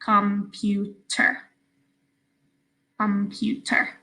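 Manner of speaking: Computer in three evenly separated syllables. Shown as a demonstration. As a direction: sound out Computer as com-pu-ter for learners. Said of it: "this one's a little bit longer".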